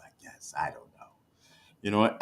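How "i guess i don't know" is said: unvoiced, hushed aside to the viewers; whispered aside. Real, not acted. Streamer confides to his chat in a whisper. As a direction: drop to a secret whisper, half joking.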